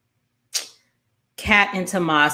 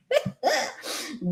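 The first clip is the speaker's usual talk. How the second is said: high, soft laugh; 5 dB quieter than usual. Real, not acted. Recapper amused by the scene she retells, laughing. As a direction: laugh lightly in a high pitch.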